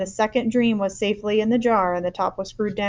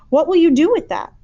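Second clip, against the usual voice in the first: put-on worried girl; 8 semitones higher, 8 dB louder than usual. A narrator's reading.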